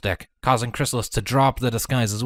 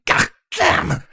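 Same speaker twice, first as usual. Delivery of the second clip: startled curse. A narrator's reading. Grappled, exclaims.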